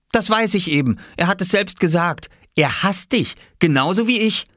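high-pitched spiteful retort; defiant little boy. Acted. Oskar getting back at his sister, defiant. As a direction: answer back as a stubborn child.

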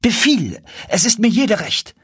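high, excited exclamation; eager cry. Acted. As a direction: cry out your consent at once. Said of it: "schrie Chasid".

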